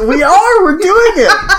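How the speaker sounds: loud, high, laughing cheer; excited cheer. Real, not acted. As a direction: shout it out in triumph. Mock triumphant cheer.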